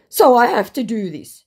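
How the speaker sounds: loud, high put-on voice; mock the scammer's excuse; hammed-up pleading justification; self-justifying scammer.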